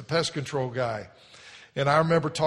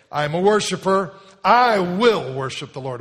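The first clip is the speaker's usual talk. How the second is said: declare it loud; 4 semitones higher, 9 dB louder than usual. Shown as a demonstration. Pastor leads congregation in loud passionate declaration. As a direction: proclaim it with passion to the room. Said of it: "we're gonna say it this way".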